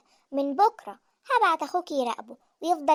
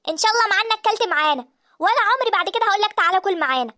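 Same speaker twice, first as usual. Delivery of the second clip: loud, high-pitched parting retort; furious shouting; 5 semitones higher, 8 dB louder than usual. Acted. Wife vows never to invite him again, furious shouting. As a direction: hurl a last vow after him.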